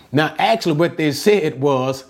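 ordinary talk, nothing marked

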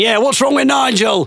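high-pitched imitated heckle; mock crowd shouting. Others back up the heckler, high mock shout. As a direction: join in like a roused pub crowd, high. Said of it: "other people start shouting".

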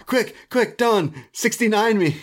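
high hammed-up frantic plea through laughter; mock urgent plea. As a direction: beg in a silly high rush. Quoting Kenny, laughing at it.

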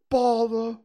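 lightly voiced frustrated bear, high and loud; Pooh stuck in jar. A narrator's reading. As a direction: grumble loudly from inside a jar. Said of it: "he said inside the jar".

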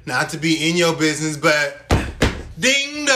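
loud, high ding dong; sassy. Pretend. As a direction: knock at the door with sass. Mock door knock.

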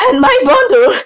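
high, gasping weaver; wheezing. A narrator's reading. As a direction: wheeze it out, drawing out the end. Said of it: "And the weaver wheezed".

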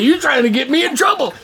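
high indignant challenge; comic protest. Retold or imitated. Man accuses God of setting him up, comic protest.

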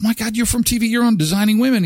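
high gushing fan; starstruck fan. Retold or imitated. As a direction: gush at the TV star. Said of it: "totally fan moment".

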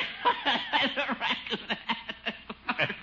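long chuckling laugh; teasing laugh. Acted. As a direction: laugh on and on at his expense. Bronco teases about initiation.

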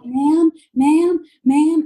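high, loud panicked call; frantic calling. Retold or imitated. Panicking shopper calls the Target clerk, frantic loud call. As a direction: flag her down in a panic. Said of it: "might not have been the most collected".

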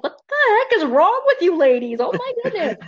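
high, exasperated disbelief; appalled. Retold or imitated. Viewer scolding the movie witches, high appalled squeal. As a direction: scold them in shocked disbelief. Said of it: "And I'm like".

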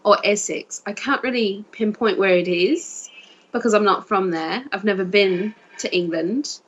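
put-on southern English accent. Shown as a demonstration. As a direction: talk as if from Sussex or Essex. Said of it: "you had that accent".

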